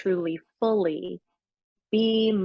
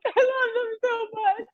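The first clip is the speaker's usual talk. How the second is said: high, teary, breaking into a laugh; crying with love as you say it; real tearful. Real, not acted. Telling the host how she loves the group, tearful.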